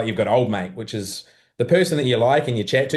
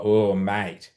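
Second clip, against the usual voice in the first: let-down, lower-key OH, MATE; negative mate; 4 semitones lower than usual. Shown as a demonstration. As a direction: say it like mild disappointment in someone. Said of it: "negative and positive depending on how you say it".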